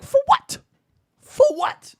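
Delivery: sky-high incredulous squeal; shrieky diva impression. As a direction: toss it out high and loud, twice. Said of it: "And she was like".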